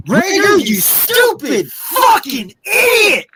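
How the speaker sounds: screaming at the player in real rage; raging high yell at the screen; furious yell. Real, not acted. Fan raging at muffed punt.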